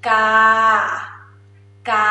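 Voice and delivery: กา held level and even for students to copy; mid tone drill. Shown as a demonstration. Teacher leads a repeat-after-me drill, flat mid tone. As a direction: say กา twice on a flat mid pitch. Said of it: "repeat after me".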